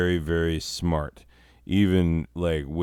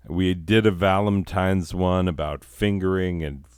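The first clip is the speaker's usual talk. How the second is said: ordinary delivery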